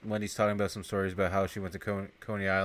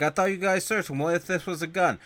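scolding boss; 8 semitones higher than usual. Retold or imitated. Duran voiced, scolding his men.

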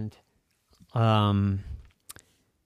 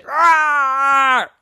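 loud high playful rawr; dinosaur roar; 11 dB louder than usual. Acted. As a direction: roar at them like a T-Rex. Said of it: "the T-Rexes said".

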